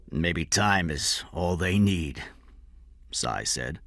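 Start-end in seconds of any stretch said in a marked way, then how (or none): 0.1-2.2 sad. A narrator's reading. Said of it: "there was a sadness in his voice".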